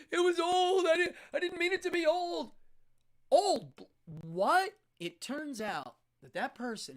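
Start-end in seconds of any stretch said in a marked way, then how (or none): 0.1-2.5 high, weepy blubbering excuse; whimpering, louder at the end; mock crying. 3.3-4.7 louder, higher double take; can't believe what was said; sharp confused what; baffled. Retold or imitated. Stunned at the excuse.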